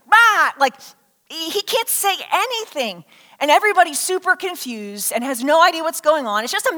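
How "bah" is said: loud, high wordless "bah!"; sudden high strangled-attempt syllable; mute man's blurt. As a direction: blurt a failed attempt at speech. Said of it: "it's like".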